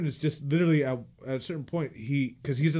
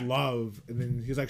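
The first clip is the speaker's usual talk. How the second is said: ordinary delivery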